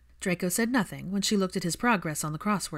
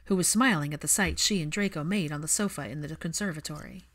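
ordinary delivery

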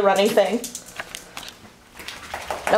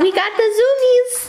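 excited squeal; 10 semitones higher than usual. Real, not acted. Excited about dog's zoomies.

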